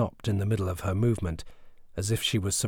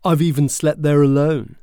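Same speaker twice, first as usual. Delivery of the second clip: defensive retort; 6 semitones higher, 8 dB louder than usual. Acted. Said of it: "Joshua retaliated".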